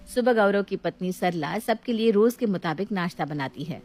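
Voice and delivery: ordinary delivery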